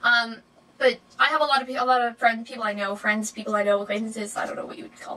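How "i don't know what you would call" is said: quick unvoiced whisper to herself; whispered aside. Real, not acted. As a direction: drop to a whisper, as if thinking aloud. Unsure what to call acquaintances.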